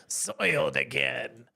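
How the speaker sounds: slow cartoon villain lament. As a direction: drag it out like a foiled bad guy. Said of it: "that, um, villain voice?".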